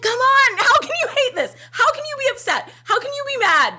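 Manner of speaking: high, loud pleading with a laugh in it; overflowing fan rant; passionate outburst. Real, not acted. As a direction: demand how anyone could hate this. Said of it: "I got too passionate there".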